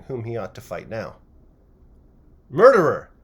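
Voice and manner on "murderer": very loud, higher accusing yell; sudden shout. A narrator's reading. Tall lad suddenly accusing the publican, loud shout. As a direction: hurl the word at the publican. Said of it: "he shouted suddenly".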